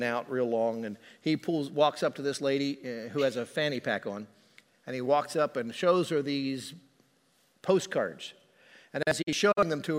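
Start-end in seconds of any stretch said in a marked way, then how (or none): none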